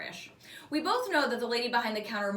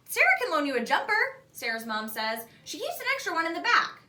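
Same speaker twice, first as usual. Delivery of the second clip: lightly voiced mom; 7 semitones higher, 4 dB louder than usual. A narrator's reading. Sarah's mom, Australian.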